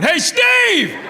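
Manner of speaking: strangers screaming across the theater; very high, full-voiced shout; exasperated yelling. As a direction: yell at him like he's hopeless. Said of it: "people we don't even know are going".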